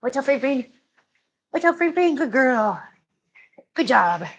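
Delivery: high, cooing pet voice; pet talk. Real, not acted. Host moving a pet out of the way, cooing.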